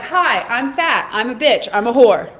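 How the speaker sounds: slow, loud mock announcement of bad labels; mock self-introduction. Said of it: "you walked into a room and you were like".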